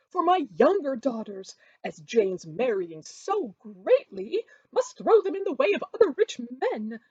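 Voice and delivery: boasting Mrs Bennet. A narrator's reading. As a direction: crow over the rich match to everyone. Mrs Bennet voiced in story.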